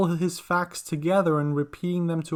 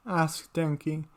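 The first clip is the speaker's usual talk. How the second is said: ordinary delivery, nothing marked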